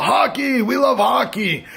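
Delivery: high-pitched mock cheer; imitated crowd yell. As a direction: chant it like an excited crowd. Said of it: "and then they yelled out".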